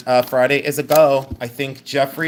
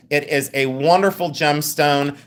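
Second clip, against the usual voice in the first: ordinary delivery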